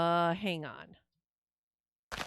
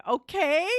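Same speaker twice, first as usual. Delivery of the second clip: pleading okay; 6 semitones higher, 4 dB louder than usual. Real, not acted. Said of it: "if I'm not laughing, I'm crying".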